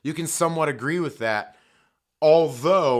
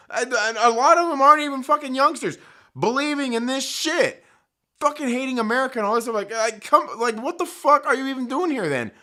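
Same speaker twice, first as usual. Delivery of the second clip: higher and louder, heated; venting real outrage at them; angry rant; 7 semitones higher, 3 dB louder than usual. Real, not acted. Angry rant at anti-American youth.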